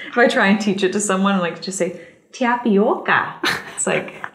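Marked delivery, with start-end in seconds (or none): 2.3-3.4 Tapioca drawn out slowly in a Midwest accent. Shown as a demonstration. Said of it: "I like saying tapioca in that accent".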